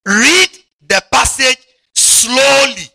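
each word drawn out and called loud; slow it right down and call it out; loud, slow preaching emphasis. Shown as a demonstration. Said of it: "Everybody say".

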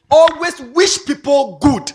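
loud, slow, high punchline; slow, loud emphasis; stressed moral. Real, not acted. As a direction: press each word home. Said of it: "moral stressed as lesson".

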